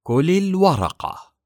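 slow, high and loud, every word weighed; very slowly. A narrator's reading. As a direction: drag the order out coldly. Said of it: "وقالت ببطء شديد". (ببطء شديد وبصوت عال)

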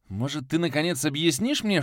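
high, heated demand; exasperated outburst. Acted. The hero again flares up at Viktor, heated. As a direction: demand an answer, fed up. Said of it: "Вновь взорвался я".

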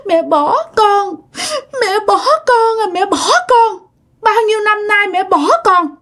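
put-on high, tearful little-child voice; sulky toddler crying to her mother. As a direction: plead like a sobbing two-year-old. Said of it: "cái giọng của bà như là một đứa con nít".

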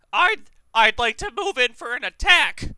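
high, booming adventurer voice; character voice. Acted. Player announcing an attack to the game master, loud character voice. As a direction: declare it loudly in a high put-on voice. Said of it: "I wasn't using my character voice".

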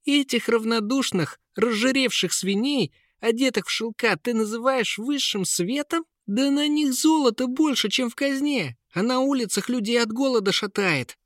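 put-on high, impassioned boyish denunciation; indignant tirade. Prince denounces the rich court. (гневная обличительная речь)

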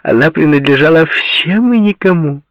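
high, loud, animated amusement; amused, laughing. A narrator's reading. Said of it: "засмеялась ещё громче".

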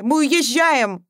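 mother overriding all objections; emphatic insistence. A narrator's reading. Mother repeats, more insistent.

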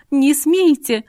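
loud, high frightened cry; frightened shout. A narrator's reading. Terrified mother shouts at the thug, loud and high. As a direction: scream to stop them. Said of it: "закричала перепуганная мама".

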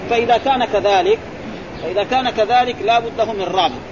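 normal talking voice

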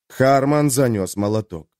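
ordinary delivery, nothing marked

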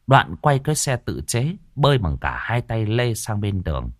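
plain reading voice

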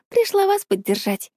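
high-pitched, lightly affected mimicry; copying a friend's intonation. A narrator's reading. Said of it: "явно копируя движение и интонацию Лары".

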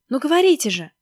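loud, high demanding cry; shouting. Acted. Maskin yells at the silent caller, shouting. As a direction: shout impatiently into the phone. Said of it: "схватил трубку и закричал".

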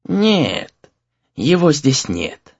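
put-on slow, drawn-out elderly voice; old man. A narrator's reading. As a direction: answer slowly as an old teacher. Old exorcist voiced by narrator.

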